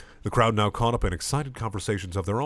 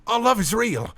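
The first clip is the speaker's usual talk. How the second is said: much higher, louder joyful cry; excited high shout; 14 semitones higher, 5 dB louder than usual. Acted. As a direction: shout it in delight. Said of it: "Purpur shouts excitedly".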